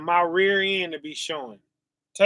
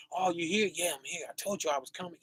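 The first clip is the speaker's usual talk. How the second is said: hushed Christ voice; 11 dB quieter, much faster than usual. Acted. Preacher voices Christ slipping in quietly at night, hushed. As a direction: murmur it like a thief at the window.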